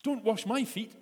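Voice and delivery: loud, high protest; loud high-pitched refusal; Peter protesting. Acted. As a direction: shout it, refusing outright.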